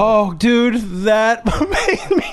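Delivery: high, laughing through the words; laughing hard. Real, not acted. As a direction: still helpless with laughter. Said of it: "I've never seen somebody laugh so hard".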